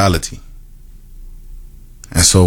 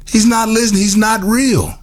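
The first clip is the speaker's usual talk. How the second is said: much higher, pleading voice as if to an absent God; high despairing cry; 14 semitones higher than usual. Retold or imitated. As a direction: let the voice leap up in retold despair. Retold despair at silent God.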